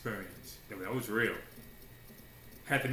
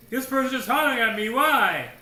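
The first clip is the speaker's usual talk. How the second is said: louder, higher, fast exasperated complaint; exasperated outburst; 10 semitones higher, 11 dB louder than usual. Acted. A churchgoer mocking someone yelling at them, exasperated and loud. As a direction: fling it out like you're fed up with being yelled at.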